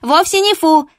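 high, loud ringing yap; indignant little dog yapping; yappy little dog. Acted. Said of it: "звонко тявкнула Чупа".

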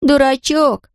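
put-on high teasing girl voice; mildly amused name-calling. A narrator's reading. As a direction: tease him, high and light. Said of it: "засмеялась Марлотта".

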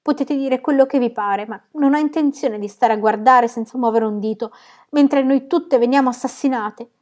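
loud, high-pitched protest; frantic shouting. A narrator's reading. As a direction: cry out in panic, building in pitch to the end. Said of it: "gridò correndo selvaggiamente alla finestra".

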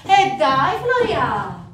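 loud, high, drawn-out plea; pleading whine. Acted. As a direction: beg loudly like a pestering girl. Girl pleads in acted quarrel.

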